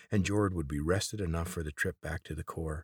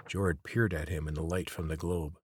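ordinary delivery, nothing marked

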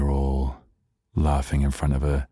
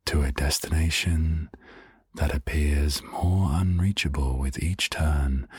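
dreamy narration. Pretend. Dreamy story about winding road.

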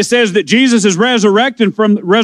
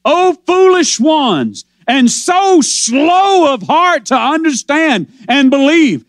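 shouted rebuke; 4 semitones higher, slower than usual. Acted. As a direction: call it out slowly like a preacher rebuking. Preacher crying out Jesus' rebuke.

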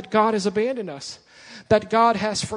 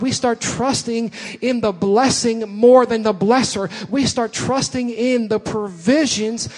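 preaching at full force; 3 semitones higher, 5 dB louder than usual. Acted. Preaching builds in intensity.

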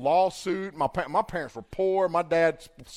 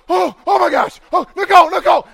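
much higher and louder calling her name; panicked shout; 12 semitones higher, 14 dB louder than usual. Retold or imitated. The speaker crawls over to Nicole, shouting her name in panic. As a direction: cry out in alarm as you crawl over.

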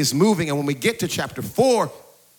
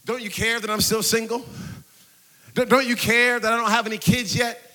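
building desperate plea; 6 semitones higher than usual. Acted. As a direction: start subdued, build higher into insistent, impassioned appeal. Acted escalating plea to Jesus.

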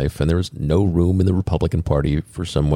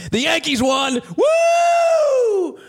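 cheering shout; much slower than usual. Real, not acted. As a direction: cheer like a fan. Cheering Yankees win.

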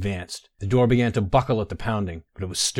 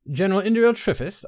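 higher, louder call through a door; 10 semitones higher, 4 dB louder than usual. Acted. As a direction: shout the name to someone inside. Guard calling through door.

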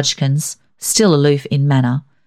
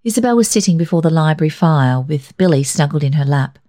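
ordinary delivery

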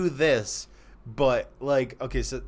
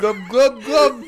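high, loud laughing glubs; gurgling fish voice; 11 semitones higher, 11 dB louder, slower than usual. Pretend. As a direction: blow fish bubbles with your voice. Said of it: "Mister Goldfish".

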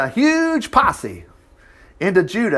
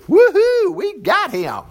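gleeful shout; 14 semitones higher, slower than usual. A narrator's reading. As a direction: whoop like a victorious mob. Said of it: "shouted with glee".

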